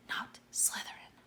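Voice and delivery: a silent, breathless whisper; whispered plea. A narrator's reading. Harry silently pleading with the hat, whispered. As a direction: beg it under your breath. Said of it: "and thought".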